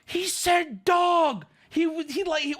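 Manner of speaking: high, drawn-out cry of protest; exasperated insistence. Real, not acted. Storyteller correcting the duck suggestion, exasperated cry.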